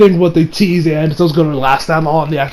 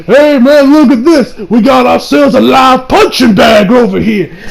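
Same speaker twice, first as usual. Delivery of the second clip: higher and louder mocking thug voice; gloating villain; 5 semitones higher, 4 dB louder than usual. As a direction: sneer it like a bully who found a victim. Shrimp villain gloats over Kale.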